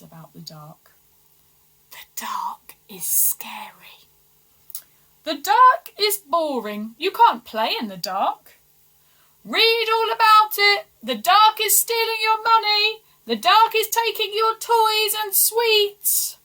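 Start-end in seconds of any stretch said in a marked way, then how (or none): 1.9-4.0 hushed rumour whisper; slow, breathy rumour-monger whisper. Acted. Advisers spreading whispered rumours. 5.2-8.6 higher, louder gossiping claim; loud rumour. Acted. Advisers spreading rumours loudly. 9.4-16.4 high, loud street paper-seller call; newsboy hollering the headlines at passers-by; newspaper seller cry. Acted.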